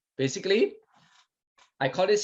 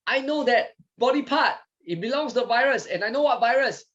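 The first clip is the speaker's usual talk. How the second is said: high-pitched announcement of recognition; killer T cell speaking; 8 semitones higher than usual. Acted. Killer T cell answers the messenger, high-pitched declaration. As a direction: pipe up high as the cell who knows. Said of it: "the killer T cell that says".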